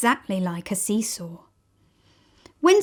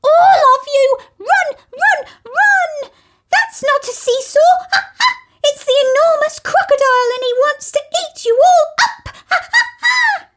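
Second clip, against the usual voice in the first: very high, loud, fast panicked screeching; monkey screaming that it's the crocodile; frantic monkey shriek; 8 dB louder than usual. Acted. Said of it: "Mugglewump shouted to the children".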